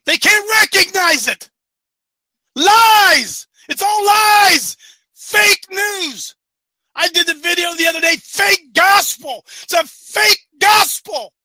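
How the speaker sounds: loud, high, slow preaching cry; holy and righteous. Acted. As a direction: denounce the lies like a prophet. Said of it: "you're sounding all holy and righteous".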